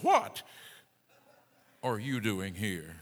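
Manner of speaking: God challenging him, hit What hard and drop the rest; stress on What. Acted. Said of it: "Put the emphasis where you will".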